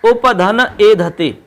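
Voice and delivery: उपधन ए धते drawn out slowly, word by word; slow, deliberate. Shown as a demonstration. Teacher repeating the example slowly for students. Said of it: "कौन सा उदाहरण है?".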